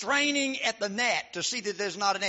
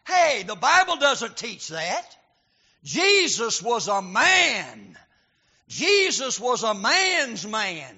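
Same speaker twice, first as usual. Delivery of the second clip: louder, slow insistent claim; emphatic preaching; 2 semitones higher, 7 dB louder, slower than usual. Acted. Preacher correcting the mild picture, louder slow insistence. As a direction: lean hard on every word.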